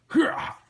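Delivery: sudden high, loud lunge cry; acted high-pitched attack shout; attack yell. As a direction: burst out with a short battle cry. Acted thug lunging in the comic.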